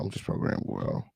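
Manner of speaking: unvoiced low mock robot murmur; whispered aside. Host whispering a joke to chat, breathy aside. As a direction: drop to a secret whisper.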